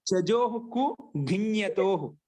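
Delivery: slow, spaced-out sutra; sutra recitation. Shown as a demonstration. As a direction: recite it slowly with drawn-out endings. Introduces a new sutra.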